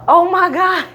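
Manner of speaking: sudden loud, high oh my God; shocked by the crack in the neck; surprised gasp. Real, not acted. Patient reacting to a neck crack, surprised. First crack during chiropractor adjustment.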